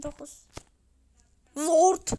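ordinary talk

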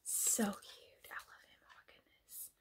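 soft unvoiced adoring whisper. Real, not acted. Cooing over a tiny dragon, whispered. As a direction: whisper it like a secret.

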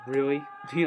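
ordinary talk, nothing marked

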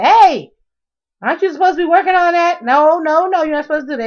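loud, high scolding call; daughter hollering. Retold or imitated. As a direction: holler at her like a bossy daughter. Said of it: "she'll holler at me and say".

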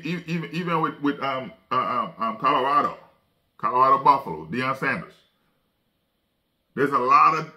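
ordinary talk

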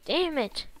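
loud, annoyed curse; frustrated shout. Real, not acted. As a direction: bark it out in frustration. Frustrated at dying.

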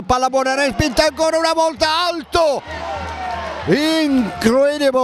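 rising frantic play-by-play; high, fast, shouted call; breathless excited commentary. Real, not acted. Live chance commentary. (telecronaca concitata e urlata)

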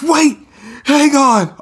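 high, loud urgent voice; urgent rescuer. Acted. Rescuer urging a victim to hang on, high urgent plea. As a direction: hold on, pleading.

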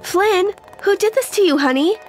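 alarmed. Acted. Girlfriend finding her boyfriend tied up, alarmed. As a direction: voice lifted in sudden concern.

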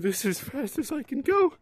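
high whiny mock crying; mock whine. Real, not acted. Whining about slow monkey.

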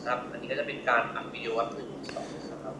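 quiet, tinny radio playback; over walkie talkie. Real, not acted. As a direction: sound like it's coming through a handset speaker. Recording played back from walkie talkie.

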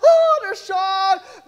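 mock shock. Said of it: "Everyone's like".

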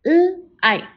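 L-ike split into onset and long vowel; segmented. Shown as a demonstration. Teacher sounding out L-ike for the class. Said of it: "Repeat after me".

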